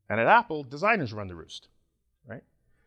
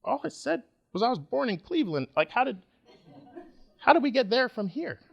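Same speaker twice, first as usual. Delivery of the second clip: much higher, bewildered protest with a laugh; 9 semitones higher than usual. Retold or imitated. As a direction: plead confusion like a wrongly judged Midwesterner. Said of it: "people are like".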